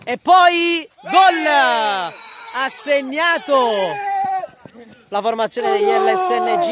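shouted, stretched goal call; breathless goal call. Real, not acted. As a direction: scream the goal out, slowly. Goal scored, commentator erupts. (urlo da gol, senza fiato)

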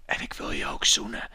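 hushed mock-romantic whisper; whispered aside. Hero confessing to the princess, whispering. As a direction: whisper it like a secret.

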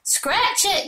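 high playful yell. A narrator's reading. Avery yells back at his sister from the swing. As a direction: call it back while swinging away. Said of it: "yelled Avery".

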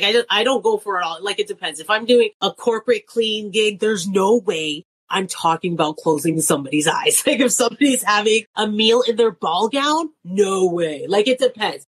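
usual speaking voice

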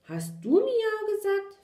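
high, loud puppy demand; insistent question. A narrator's reading. Wet puppy questioning someone new, loud and insistent.

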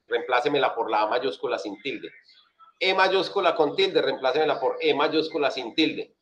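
ordinary talk, nothing marked

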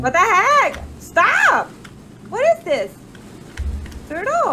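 high panicked yelling; scared horror game streamer. Real, not acted. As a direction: shriek in real panic at the monster. Horror game, something attacks.

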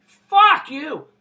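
a loud, high, angry yell at the downstairs neighbour; furious shout. Real, not acted. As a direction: bellow it down at whoever is cooking steak below. Yells at a neighbour cooking downstairs.

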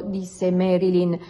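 plain reading voice

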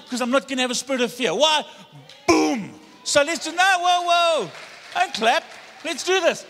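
loud, high, fast shouts; shouting preacher. Real, not acted. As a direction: whip the room up. Shouting sermon climax.

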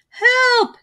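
high, loud cry for help; yelling for help. A narrator's reading. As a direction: shout it dangling upside down. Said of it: "he yelled".

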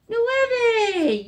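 high, loud cheer; excited answer. Acted. Teacher cheering the right flashcard answer.

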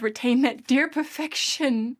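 normal talking voice

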